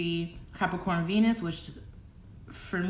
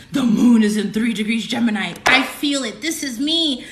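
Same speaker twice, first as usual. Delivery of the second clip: high, rising, hammed-up elation; overwhelmed by energy; 7 semitones higher, 4 dB louder than usual. Streamer reliving feeling the moon's energy, ecstatic high declaration. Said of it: "felt that energy so strongly, I was like".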